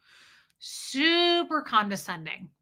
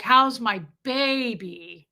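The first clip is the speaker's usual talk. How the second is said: slow, sugary coo; mother-in-law cooing at her grown son, slow and syrupy; syrupy doting mother; much slower than usual. Retold or imitated. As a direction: stretch it out like sticky syrup. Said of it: "slimy and, like, syrupy and weird".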